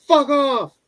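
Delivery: very high, loud mock shout; hammed-up angry outburst; Vegeta. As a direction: yell a curt dismissal in a put-on voice. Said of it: "And he goes".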